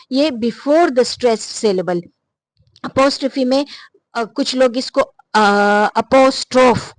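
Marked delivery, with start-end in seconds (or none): 0.3-2.0 slow explanation. Shown as a demonstration. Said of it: "apostrophe कहाँ पे आता है".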